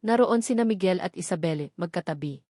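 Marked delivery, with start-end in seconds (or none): none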